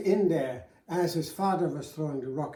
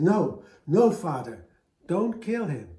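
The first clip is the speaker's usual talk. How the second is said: louder and higher, crying out to stop him; urgent plea; 3 semitones higher, 8 dB louder than usual. Acted. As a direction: shout to save the hare. Son begs father to spare hare.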